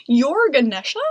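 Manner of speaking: loud, surprised question; amazed to meet him at last; exclaiming. A narrator's reading. Old man recognising Ganesha, loud surprised exclaim. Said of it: "the old man exclaimed".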